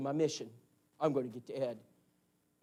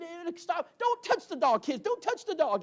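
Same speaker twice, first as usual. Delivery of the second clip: urgent yell; 11 semitones higher than usual. Retold or imitated. Father yelling at kids.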